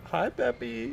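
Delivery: high, sing-out greeting to a pet; cooing pet greeting. Real, not acted. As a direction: greet your cat in a high, cutesy voice. Streamer greets pet mid-task.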